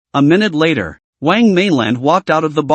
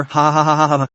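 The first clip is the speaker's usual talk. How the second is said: ha ha ha, teasing cackle; mocking laugh; faster than usual. A narrator's reading. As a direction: laugh right in her face.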